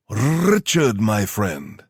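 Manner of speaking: put-on theatrical British accent with rolled Rs; hammed-up British greeting, loud high start; feigned British accent. A narrator's reading. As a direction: put on a British voice, high swoop on the name, rolling the R. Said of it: "feigning a British accent and rolling the R's theatrically".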